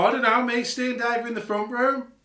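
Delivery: high, loud protesting answer; retold shouted reply. Son downstairs calling up to dad, shouted denial. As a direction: holler back as if through the floor.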